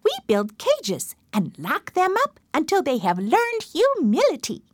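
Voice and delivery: high, rising chimp voice with a sly plan; scheming chimpanzee. Acted. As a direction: squeak it up like a clever ape. Chimpanzee proposes cages.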